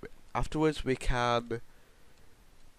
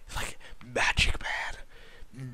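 whispered, airy aside; mock-mystical whisper; 3 dB quieter, much slower than usual. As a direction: whisper it like a secret. Hushed joke about magic.

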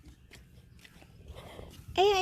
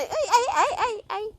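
laughing chide; 7 semitones higher than usual. Real, not acted. Mother laughing while chiding.

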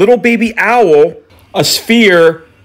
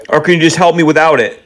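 ordinary talk

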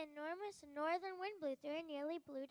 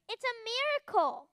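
joyful cry; 7 semitones higher, 10 dB louder than usual. Acted. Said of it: "The head elf cried".

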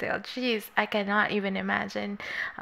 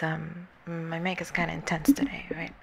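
whispered; 5 semitones lower, 5 dB quieter than usual. Real, not acted. Talking about her loud mic.

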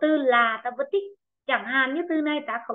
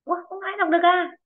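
surprised; 7 semitones higher, faster than usual. Real, not acted. Teacher surprised nobody answers.

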